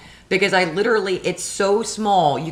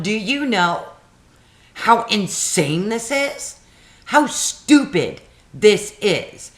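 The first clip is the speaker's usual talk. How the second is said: retold raised voice; 4 semitones higher, slower than usual. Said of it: "I'm like".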